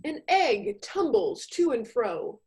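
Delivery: ordinary talk, nothing marked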